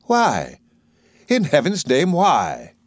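emphatic, baffled questioning; bewildered exclamation; sincere surprise. A narrator's reading. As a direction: ask in genuine astonishment. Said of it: "Higgins stares after her in sincere surprise".

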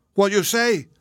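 put-on high, excited old cook; astonished cry. A narrator's reading. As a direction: cry out in joyful disbelief. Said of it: "cried the Negro".

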